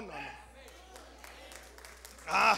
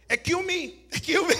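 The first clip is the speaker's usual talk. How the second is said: put-on Spanish-accented excuse me, higher; 7 semitones higher, 11 dB louder than usual. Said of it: "y a la hora de". (acento hispano al decir excuse me)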